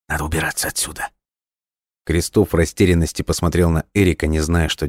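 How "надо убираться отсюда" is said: muttering. A narrator's reading. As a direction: mutter it fast, as if to yourself. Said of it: "пробормотал он".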